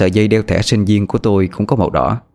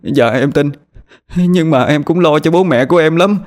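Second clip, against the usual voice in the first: sobbing; 8 semitones higher than usual. A narrator's reading. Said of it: "trả lời trong từng tiếng nấc".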